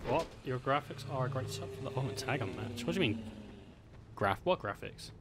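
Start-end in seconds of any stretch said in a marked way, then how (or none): none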